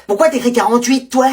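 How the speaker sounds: usual speaking voice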